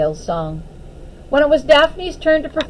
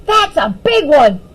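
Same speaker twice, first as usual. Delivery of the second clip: shouting; 4 semitones higher, 5 dB louder than usual. A narrator's reading. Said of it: "Puddle shouted".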